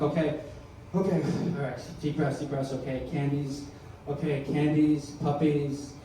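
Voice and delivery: soft, low muttering to himself; hushed attempt to calm down; self-soothing. Panicked man trying happy thoughts, soft mock self-soothing.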